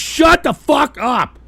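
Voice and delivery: real anger, hammer each word; angry shout. Real, not acted. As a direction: bark it slowly and loud. Yelling at trolls.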